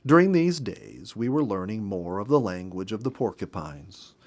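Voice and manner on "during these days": plain reading voice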